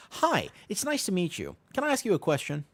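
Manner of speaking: mock-sunny small talk opener; bright friendly stranger greeting. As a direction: chirp a hello to someone on a bus.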